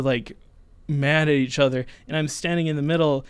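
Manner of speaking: ordinary talk, nothing marked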